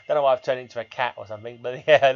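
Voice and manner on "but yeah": normal talking voice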